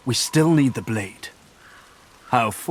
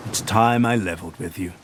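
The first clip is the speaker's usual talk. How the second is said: long sigh, then softer and lower; heavy sigh; 5 semitones lower, 5 dB quieter than usual. Acted. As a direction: sigh deeply before confessing. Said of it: "He breathes a long, lugubrious sigh".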